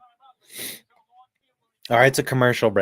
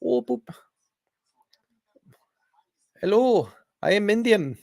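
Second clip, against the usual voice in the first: mock Indian accent; 8 semitones higher, 3 dB quieter than usual. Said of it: "I guess you gotta sound like the dude from, uh, The Simpsons".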